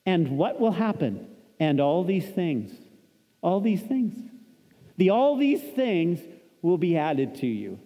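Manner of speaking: measured preaching cadence; sermon delivery. Acted. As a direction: preach it to the congregation.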